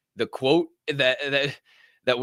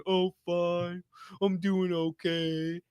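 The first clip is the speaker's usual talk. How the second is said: mock listless reply; 3 semitones higher, 7 dB quieter, much slower than usual. Mocking people's listless replies.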